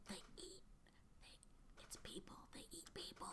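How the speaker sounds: hushed secret whisper. Acted. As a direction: whisper it so nobody hears. Recruiter confides the secret.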